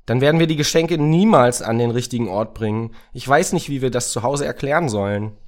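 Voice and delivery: loud, high-pitched lament; fretful Racket, despairing. Acted. Racket slumped in the snow beside Samira, loud exasperated despair. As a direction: wail that the whole job is ruined.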